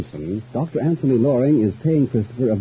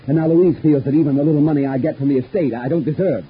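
louder, higher agitated complaint; bitter grievance; 7 semitones higher, 5 dB louder than usual. Acted. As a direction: pour out your resentment. Bitter complaint about sister-in-law.

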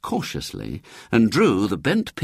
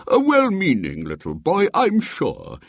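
higher, benevolent judgement; kindly bishop; 10 semitones higher than usual. A narrator's reading. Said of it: "said the bishop kindly".

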